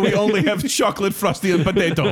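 mock Russian voice, deadpan threat; heavy russian accent menace. Joke in Russian persona.

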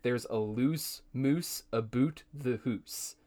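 slow, careful Scottish phrase; Scottish accent phrase. Shown as a demonstration. Friend passing on a Scottish test phrase, slow Scottish accent. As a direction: lean into a Scottish lilt on the hoose. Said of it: "And the phrase is".

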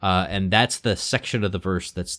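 normal talking voice

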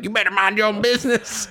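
high-pitched mock provoker; mock confrontation. Voicing guys who start fights, mock warning. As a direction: jeer it in a put-on high voice.

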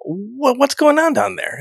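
high alien voice. Retold or imitated. Voicing aliens.

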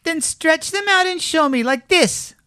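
high-pitched bossy rabbit; challenging high-voiced show-off; wild rabbit. A narrator's reading. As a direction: order him about in a high rabbit voice. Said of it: "said the wild rabbit".

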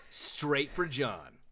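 "Straight for John" drawn out slow, each word leaned on; slow emphatic punchline. Acted. Host telling cohost who the woman chooses, slow emphatic punchline. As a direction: let the joke land word by word.

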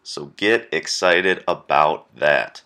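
loud, upbeat host announcement; podcast intro read. Acted. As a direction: sell the episode like a show opener.

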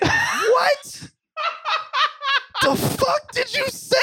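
high, laughing indignant shout; hammed-up offended disbelief; mock outrage. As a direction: react as if gravely insulted. Acting offended at the slur.

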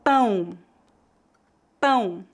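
Pão with a clear nasal ão diphthong. Shown as a demonstration. Teacher models Pão for learners, nasal diphthong. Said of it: "feita com o ditongo nasal".

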